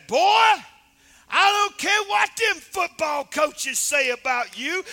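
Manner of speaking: high, loud, slow shouted threat; furious mama. Retold or imitated. Mama with a broom scolding her son, slow furious shout. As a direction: yell it down at a big son. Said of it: "She said".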